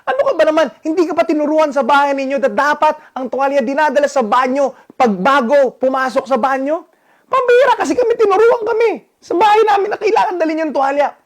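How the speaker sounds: husband scolding wife over the forgotten towel, furious; angry outburst. Retold or imitated. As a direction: blow up at her over the towel. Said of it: "Galit na galit ako".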